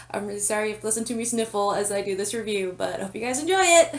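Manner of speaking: ordinary talk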